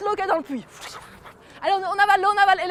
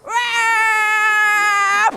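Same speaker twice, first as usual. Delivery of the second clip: loud drawn-out cry of pain; long pained cry; 3 semitones higher, 5 dB louder than usual. Acted. The stung king cries out, loud and drawn out. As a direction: howl as the stings burn.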